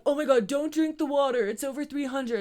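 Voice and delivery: mock them freaking out over nothing; mock panic. Mocking group panicking about water.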